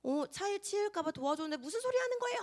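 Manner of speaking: climbing to a high, outraged protest; indignant outburst. Retold or imitated. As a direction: act out being falsely accused, rising to a shout. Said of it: "그렇게 화를 낼 것 같지만".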